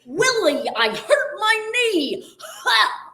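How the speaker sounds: high, slow, loud alien whine; Alf impression. Party trick for podcast hosts, slow high Alf whine. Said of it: "I'll give you a little Alf".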